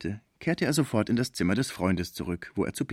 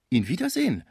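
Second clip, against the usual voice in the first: startled cry; 7 semitones higher than usual. A narrator's reading. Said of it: "rief Schmucke".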